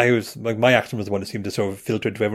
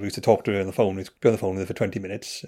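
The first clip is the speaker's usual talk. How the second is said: normal talking voice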